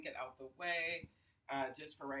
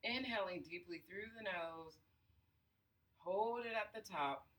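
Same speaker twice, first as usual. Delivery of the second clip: guided breathing. Acted. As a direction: count the breaths, unhurried and soothing. Leads a breathing exercise.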